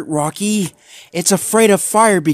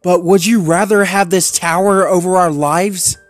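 higher, louder, held-back retort; restrained anger; 9 semitones higher, 5 dB louder than usual. A narrator's reading. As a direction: Zuma challenges Rocky, biting back anger. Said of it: "biting back a retort".

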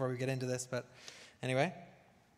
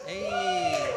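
loud high greeting yell; shouting; 9 dB louder than usual. Real, not acted. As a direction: shout hey across the room. Stream opening, greeting the room.

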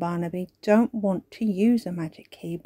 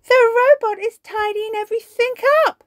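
high alarmed cry; 8 dB louder than usual. Acted. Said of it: "cries Holly".

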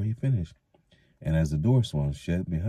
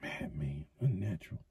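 under his breath; 11 dB quieter than usual. A narrator's reading. Thatcher muttering to himself, very quietly. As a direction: mutter it so no one hears. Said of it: "grumbled under his breath".

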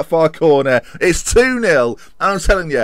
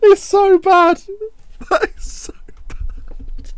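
high squeaky laughter breaking into a wheeze; laughing so hard it hurts; crying with laughter at the screen; helpless laughing; much slower than usual. Real, not acted. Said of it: "I'm laughing, but I want to die".

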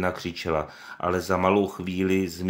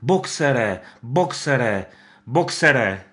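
higher, louder repeated calls; shouting; 6 semitones higher, 6 dB louder, much slower than usual. Acted. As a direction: keep calling his name. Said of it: "křičela".

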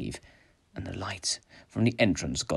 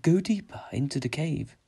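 higher, eerie motherly voice; high, unhurried ghostly summons; mother's voice; 7 semitones higher than usual. A narrator's reading. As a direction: lure him on as a disembodied mother. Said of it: "it sounded like his mother".

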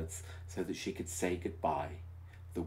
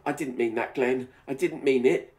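sobbing; 6 semitones higher, 7 dB louder than usual. Acted. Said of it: "she sobbed".

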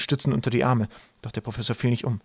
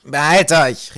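loud creature's call, pitched up; loud order from up ahead; calling back; 4 semitones higher, 10 dB louder, much slower than usual. A narrator's reading. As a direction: shout it back to the group. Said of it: "rief Ratz zurück".

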